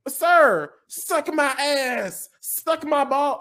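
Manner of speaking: high, slow, drawn-out mock sweetness; mock-polite clapback at the customer; sassy comeback. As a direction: stretch it out like a sugary insult. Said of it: "And I was like".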